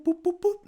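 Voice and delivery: very high, loud boop boop; mock boop taunt. Mugger taunting while grabbing fries, high loud boops. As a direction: squeak out teasing boops. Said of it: "the guy started going boop".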